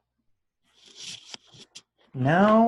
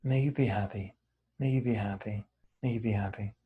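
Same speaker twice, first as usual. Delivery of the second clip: low, gentle recitation with soft falling endings; guided meditation; 3 semitones lower, 3 dB quieter, faster than usual. Real, not acted. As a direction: repeat the wish calmly, letting it settle into quiet. Recites the loving-kindness phrase.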